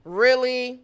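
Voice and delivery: loud mock disbelief, aimed at mom; imitated exasperated kids. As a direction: mimic a kid calling out mom, loudly. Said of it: "kids was talking shit about me".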